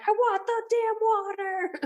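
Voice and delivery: high-pitched mock tantrum; mad. Thirsty hiker getting mad at the snake, acted mad. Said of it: "I'm getting so mad at it".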